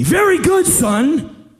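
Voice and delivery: high, loud barked praise; loud approval. Retold or imitated. Praising the boy who admits he wants money, loud. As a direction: bark the praise at him.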